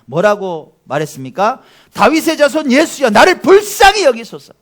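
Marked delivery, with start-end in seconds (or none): 1.9-4.5 loud, high pleading shout; shouted desperate appeal; Bartimaeus's cry. Acted. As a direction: cry out for mercy over a crowd. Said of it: "소리를 막 바락바락 지른 거예요".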